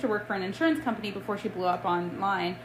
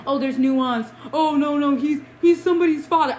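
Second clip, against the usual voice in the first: high, loud mock protest; mimicking hand-wringing defenders, pitched up; mocking objection; 5 semitones higher, 6 dB louder than usual. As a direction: whine their objections back in an exaggerated, raised voice. Mocking those who defend him.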